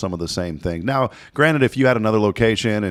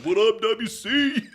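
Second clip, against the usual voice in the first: slow, high, laughing greeting; goofy greeting; much slower than usual. Pretend. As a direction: hype casual greeting. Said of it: "I'm gonna walk in and be like".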